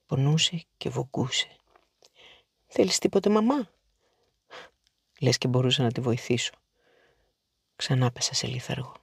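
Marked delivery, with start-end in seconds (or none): 2.7-3.7 anxious call to mama. Acted. Child calls to her ailing mother.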